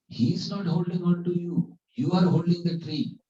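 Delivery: high, insistent retort; emphatic correcting. Retold or imitated. Disciple correcting guru who clings to tree, emphatic retort. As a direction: imitate a disciple pointing out the obvious.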